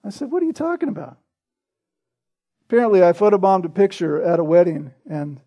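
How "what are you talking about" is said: higher, softer mock-baffled question; baffled, pitched up and hushed; puzzled retort. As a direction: act out being baffled by an accusation. Retold puzzled reply to accusation.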